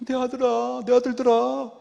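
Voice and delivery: a doting father softly begging his sons; soft pleading father. Retold or imitated. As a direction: beg gently like an indulgent old father. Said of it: "이랬다는 거예요".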